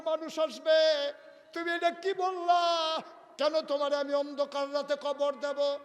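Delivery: high, loud sermon outcry; impassioned protest. Acted. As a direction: protest the wife's wish at full force. Preacher voices Ali's protest. (উচ্চস্বরে আবেগভরা প্রতিবাদ)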